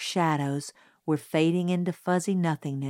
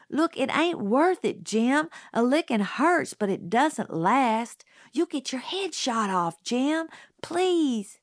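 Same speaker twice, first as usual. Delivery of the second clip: higher, climbing plea, rising to a higher Please; desperate pleading; 7 semitones higher than usual. A narrator's reading. Scout begging Jim not to risk getting shot, desperate pleading. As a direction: beg him not to go. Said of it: "I was desperate".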